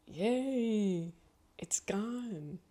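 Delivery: soft, breathy glee; secretly delighted whisper; gleeful whisper. Retold or imitated. Whispered inner thought.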